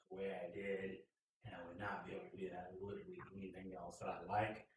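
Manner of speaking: fast, soft breathy whisper; a hurried whisper under the breath; whispered aside. Real, not acted. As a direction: drop to a quick hush.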